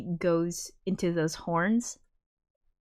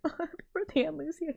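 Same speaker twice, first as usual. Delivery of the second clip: tearful quote; 10 semitones higher, 6 dB quieter, much faster than usual. Retold or imitated. Reliving a tearful movie scene.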